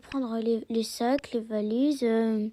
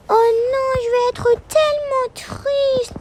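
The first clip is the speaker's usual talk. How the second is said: put-on little girl voice; 12 semitones higher, 9 dB louder than usual. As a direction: play a sad little girl who's about to move. Child voice, whining about leaving.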